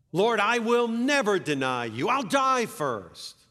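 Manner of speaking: loud, high, insistent pledge; fervent vow. Acted. Peter swears to the Lord, fervent loud vow. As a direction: swear it passionately, stretching the last words.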